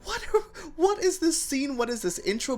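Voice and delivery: high, laughing what; laughing disbelief. Retold or imitated. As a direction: cry out in amused shock. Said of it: "I was like".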